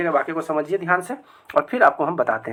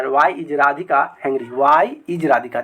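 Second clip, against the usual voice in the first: why is Radhika hungry said very slowly; slow dictation; 2 semitones lower, much slower than usual. Shown as a demonstration. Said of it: "Radhika भूखी क्यों है?". (धीरे-धीरे बोलना)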